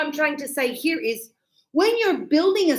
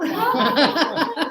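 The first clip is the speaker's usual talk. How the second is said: giggle; 5 semitones higher than usual. Real, not acted. Laughing after meowing.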